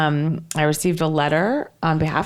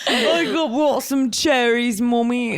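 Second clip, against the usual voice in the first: slow, higher mock mummy's boy; Max impression; 4 semitones higher, slower than usual. Son offering bar supplies to Mummy, put-on voice. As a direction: offer it as an eager son, half-laughing. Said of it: "He's like".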